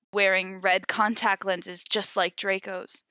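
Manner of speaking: ordinary talk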